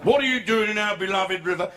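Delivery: sergeant major's indignant challenge; RSM policeman. Acted. Policeman questions the bather, sergeant-major voice. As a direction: demand an answer like a parade-ground policeman. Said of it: "Used to be an R S M".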